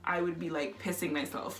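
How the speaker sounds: ordinary talk, nothing marked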